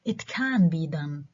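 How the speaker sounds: slow, emphatic "can"; stress on CAN. Shown as a demonstration. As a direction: draw out "can" to affirm it. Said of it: "I can stress can".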